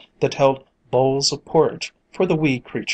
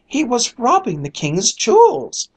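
high, louder accusation; accusing cry; 10 semitones higher, 5 dB louder than usual. A narrator's reading. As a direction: cry out the charge. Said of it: "cried another".

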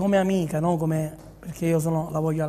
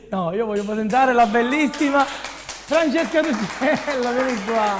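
slow, higher grand introduction; showy game show host; 4 semitones higher, 3 dB louder, slower than usual. Pretend. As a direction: present her like a showman. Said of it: "Devo fare il presentatore io".

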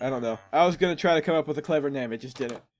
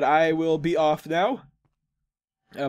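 ordinary delivery

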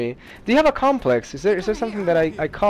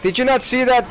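higher-pitched protest; indignant arguing; 7 semitones higher than usual. Real, not acted. Player defending his play to a teammate, indignant. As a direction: argue back in exasperation.

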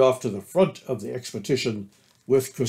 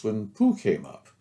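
ordinary delivery, nothing marked

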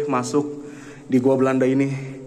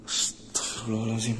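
hushed gasp; 2 semitones lower, 10 dB quieter than usual. Real, not acted. Startled in dark cave.

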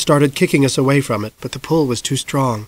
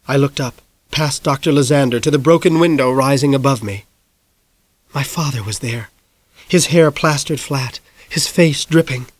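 usual speaking voice

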